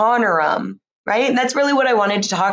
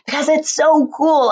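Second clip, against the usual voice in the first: excited; 7 semitones higher than usual. Real, not acted. Enthusing about friendship.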